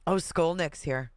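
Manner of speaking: normal talking voice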